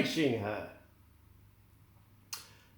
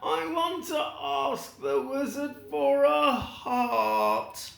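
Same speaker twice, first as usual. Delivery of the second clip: Tin Man wishing; 10 semitones higher than usual. Acted. Tin Man explains his wish.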